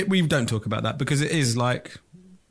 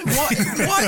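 ordinary talk, nothing marked

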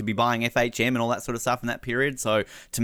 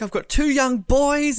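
much higher, louder and slow, hammed-up tearful; mock emotional Nicole; 5 dB louder, slower than usual. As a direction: squeak it out like a teary contestant. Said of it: "And she's like".

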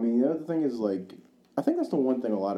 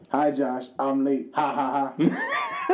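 mocking Jack; 4 semitones higher, much slower than usual. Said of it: "He's like".

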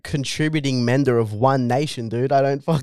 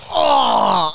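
loud surprised shout; mimicking an impressed friend; mock surprise; 4 dB louder than usual. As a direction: bark a startled Oh. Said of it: "they're just like".

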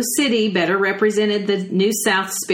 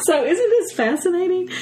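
excited high voice; 12 semitones higher than usual. Real, not acted. Excited about the article.

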